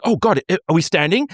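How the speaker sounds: mock alarm. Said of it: "the people next to you would be like".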